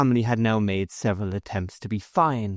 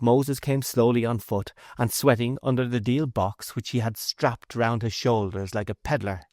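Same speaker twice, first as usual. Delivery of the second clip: ordinary delivery, nothing marked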